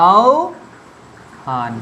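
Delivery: আহ্বান very slowly, ao, pause, han; syllable-by-syllable demo. Shown as a demonstration. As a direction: split আহ্বান into a long "ao" and a separate "han". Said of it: "ও হবে". (আহ্বান ভেঙে ধীরে "আও... হান")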